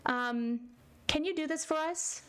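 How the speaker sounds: parents asking. Acted. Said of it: "when the parents said".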